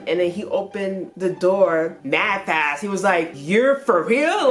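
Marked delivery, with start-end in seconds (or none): 3.3-4.5 high, drawn-out incredulous question; raised-pitch retold astonishment; disbelief. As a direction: imitate amazed disbelief, stretching it out. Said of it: "Like, in his native tongue".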